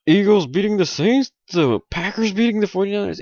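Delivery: lightly mock a doubting fan; mocking doubters. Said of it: "a lot of people will say".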